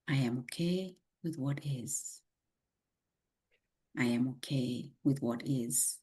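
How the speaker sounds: calm, unhurried meditation guide; guided meditation. Acted. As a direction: lead the breathing gently.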